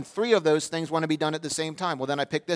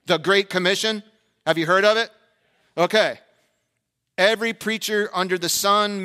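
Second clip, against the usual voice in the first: loud, raised-pitch pulpit call; rousing preaching; 6 semitones higher, 9 dB louder than usual. Real, not acted. Preacher asking the church about the Great Commission, rousing. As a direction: ring it out to the whole church.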